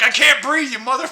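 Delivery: lightly voiced outraged fish; mock fish voice. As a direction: play the hooked fish cursing you out. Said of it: "the fish is like".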